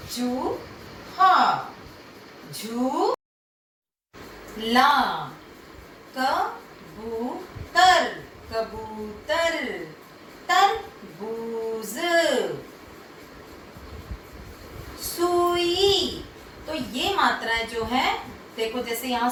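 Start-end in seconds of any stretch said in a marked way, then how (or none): 0.1-8.0 चूहा, झूला and कबूतर drawn out slowly with a long ऊ; long oo demo. Shown as a demonstration. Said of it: "शब्दों के माध्यम से आप सुनो". 10.5-16.2 तरबूज and सुई very slow, the long ऊ held; long oo demo. Shown as a demonstration. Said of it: "शब्दों के माध्यम से आप सुनो".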